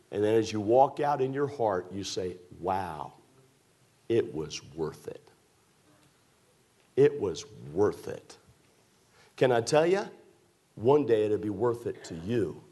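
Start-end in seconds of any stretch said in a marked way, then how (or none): 2.6-8.4 mild wonder. Retold or imitated. Bride's inner joy at wedding.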